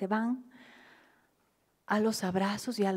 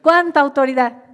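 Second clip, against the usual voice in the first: loud and higher, exclaiming; emphatic shout; 8 semitones higher, 16 dB louder than usual. Acted. Pastor marveling at Jesus' authority, shouting. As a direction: burst out with conviction.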